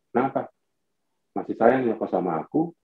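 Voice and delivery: low, soft questioning; night-time wife. Acted. Wife waking her husband at night, soft and tender. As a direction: ask low and softly, seeking affection. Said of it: "Malam-malam aku dibangunin tuh".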